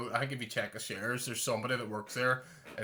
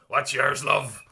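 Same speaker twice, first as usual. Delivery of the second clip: a touch of a Derry owner, louder; hammed-up "What's yours, love"; mock waitress; 8 dB louder, much slower than usual. In a soothing, phlegmy tone.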